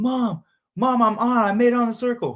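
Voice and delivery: calling mom with the big news; excited. Retold or imitated. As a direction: blurt it out to mom, thrilled. Retold call to his mom.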